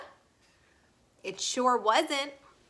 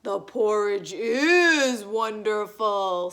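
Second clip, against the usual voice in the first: slow, lower bear voice; Papa Bear voice; 3 semitones lower, 3 dB louder than usual. A narrator's reading. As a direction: rumble it slowly like a big papa bear. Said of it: "said Papa Bear".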